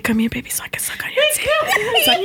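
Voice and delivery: whispered high through laughter; breathless giggling quote; laughing. Retold or imitated. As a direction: quote him while cracking up. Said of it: "He said".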